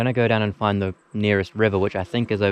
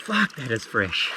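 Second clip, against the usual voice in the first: gasping from cold water; 4 semitones higher, 8 dB quieter, much slower than usual. Real, not acted. After plunging into freezing water.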